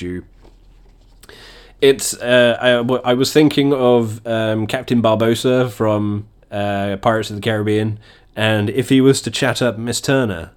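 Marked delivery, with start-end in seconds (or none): none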